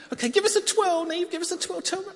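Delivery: high, bright coaxing; playful request. Real, not acted. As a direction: ask for a twirl with glee. Asks child to twirl.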